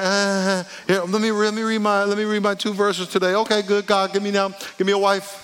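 high, monotone rattling-off; put-on high voice, going through the motions; mock dutiful prayer. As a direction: recite it like a chore to be got through. Mimics a perfunctory, self-serving prayer.

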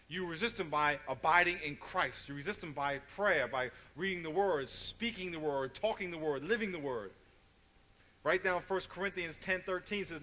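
loud, driving preacher cadence; a forceful pulpit build; preaching at full force. Real, not acted. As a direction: preach it hard to the room.

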